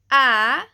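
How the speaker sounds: loud, clear "a"; name the letter firmly; letter sound. Shown as a demonstration. Teacher pointing out letter a. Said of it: "can sound like an e".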